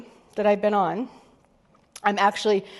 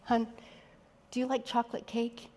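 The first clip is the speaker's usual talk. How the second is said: much softer, gentle coaxing question; tender coaxing; 9 dB quieter than usual. Retold or imitated. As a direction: coax her softly, like to a child. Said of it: "and I was like".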